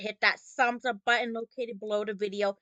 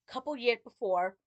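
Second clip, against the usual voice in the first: ordinary talk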